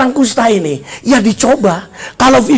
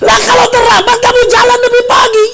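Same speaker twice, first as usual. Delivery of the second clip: high, raised mock-teacher scolding; angry rebuke; 13 semitones higher, 4 dB louder than usual. Teacher rejecting bicycle excuse, scolding. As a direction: shoot down the excuse loudly.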